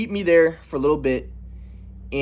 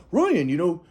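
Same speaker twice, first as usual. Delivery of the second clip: a hint of a preachy grandfather; grandpa lecturing; 5 semitones higher than usual. Grandpa lecturing grandson about the universe, lightly voiced. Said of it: "my grandpa was like".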